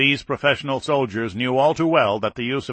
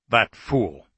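usual speaking voice

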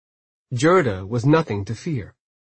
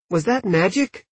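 put-on higher-pitched wondering child; curious little boy; 6 semitones higher than usual. A narrator's reading. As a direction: ask it like an amazed boy. Boy asks healer in wonder.

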